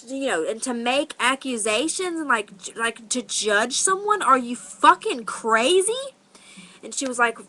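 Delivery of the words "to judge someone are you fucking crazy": incredulous challenge, rising higher and louder; outraged. Retold or imitated. Teller re-enacts challenging the older woman's accusations, outraged. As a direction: re-enact disbelief, stretching the final question.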